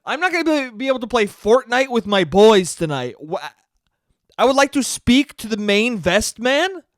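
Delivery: loud, hammed-up entitled outrage; Karen-y complaining. Karen asking for the main vest man, Karen-y complaining. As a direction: demand it loudly like an indignant Karen. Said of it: "I was getting real fucking Karen-y".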